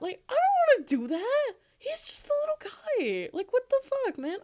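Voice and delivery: very high, loud indignant squeal; squeaky protest. Acted. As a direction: protest in a squeal on your favorite's behalf. Mock protest defending the robot.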